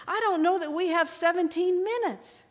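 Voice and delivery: put-on mother fleeing with her baby, urgent and afraid; desperate plea. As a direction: beg as if time is running out. Desperate mother pleads with soldier.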